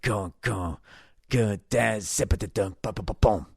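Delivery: vocalizing a drum lick with mouth sounds; drum beatboxing. Shown as a demonstration. Drummer showing a [name] lick by voice, beatboxed syllables. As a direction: sing the drum pattern as syllables. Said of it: "It's like".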